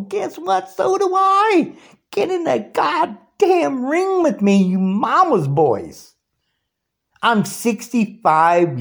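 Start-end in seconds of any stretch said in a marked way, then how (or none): 0.0-6.1 much higher, shouted dare; angry challenge. Real, not acted. Daring Trumpers into the ring, high angry shout. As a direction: yell the fight challenge up high.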